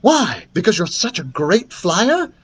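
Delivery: excited princess voice; excited wondering. A narrator's reading. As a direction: ask with eager excitement. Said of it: "Princess Pulverizer wondered excitedly".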